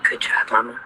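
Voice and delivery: hushed little pat on the back; whispered aside. Real, not acted. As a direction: murmur it so nobody else hears.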